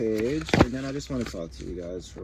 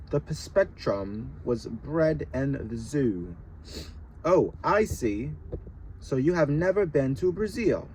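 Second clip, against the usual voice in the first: British voice; 4 semitones higher than usual. Shown as a demonstration. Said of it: "rereads in British voice".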